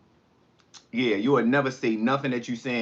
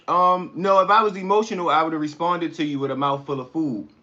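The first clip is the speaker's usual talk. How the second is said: loud retort; 5 semitones higher, 5 dB louder than usual. Real, not acted. Snapping back at a troll.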